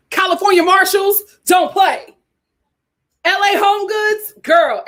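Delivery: high, loud hype shoutout; excited brag. Real, not acted. Hyping her California haul to viewers, loud and high. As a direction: shout out the stores proudly.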